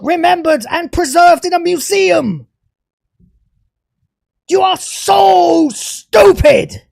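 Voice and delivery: loud, shouted rant that builds; screaming at the showrunner in rage; furious shouting. Real, not acted. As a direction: yell it out, fed up and furious. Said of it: "This angry rant".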